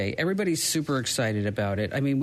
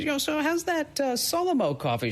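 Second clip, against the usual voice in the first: mock casual greeting; 12 semitones higher than usual. Host quoting himself to mother.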